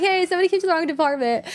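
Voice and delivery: high-pitched, quick mock announcement; calling nurse. Nurse alerting others about stray boy, mock calling out. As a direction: imitate a nurse flagging a lost kid.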